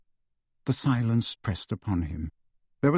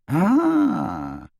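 slow, loud, lofty official; pompous minister; 8 semitones higher, 4 dB louder than usual. A narrator's reading. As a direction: drawl it out from on high. Thickness voiced in story.

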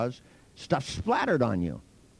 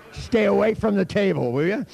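loud, high-pitched teasing order; mock warning; 7 semitones higher, 7 dB louder than usual. Real, not acted. Speaker warning an accident-prone listener off the table, mock alarm. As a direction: call it out sharply, in jest.

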